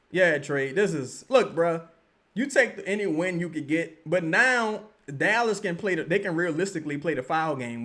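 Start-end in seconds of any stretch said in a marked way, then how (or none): none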